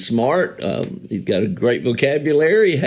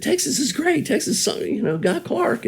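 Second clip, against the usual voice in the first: imitating Peter. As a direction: cheer for Texas in a high put-on voice. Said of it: "he said".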